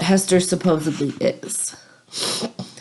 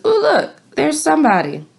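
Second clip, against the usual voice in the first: put-on much higher, delighted little girl; child Pearl's sudden excitement; excited child; 5 semitones higher than usual. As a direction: point and squeal like a small child. Pearl spots a man.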